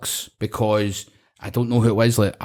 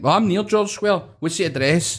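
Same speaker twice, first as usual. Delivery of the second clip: eager reply; 7 semitones higher than usual. Retold or imitated. Retold own phone reply.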